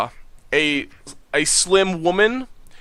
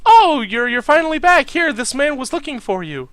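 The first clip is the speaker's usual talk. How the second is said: higher, brighter NPC greeting, a bit louder; cheerful woman's voice; 11 semitones higher, 3 dB louder than usual. Acted. Valerie greeting the returning trainer, high and cheerful. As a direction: welcome them back with relief.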